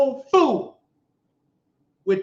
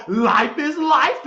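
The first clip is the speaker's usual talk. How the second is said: slow, higher mocking drawl; mock quote; 6 semitones higher, much slower than usual. Mimicking her phone talk, slow mock voice. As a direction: stretch her phrase out mockingly. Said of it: "then you get on the phone".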